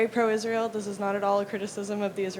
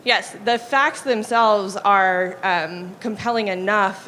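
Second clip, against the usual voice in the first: louder, slow and deliberate; speaking up; 6 dB louder, slower than usual. Real, not acted. Audience member repeats her point to the lecturer, speaking up. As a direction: raise your voice and slow down. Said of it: "Can you speak up a bit?".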